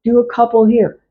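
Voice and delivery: ordinary delivery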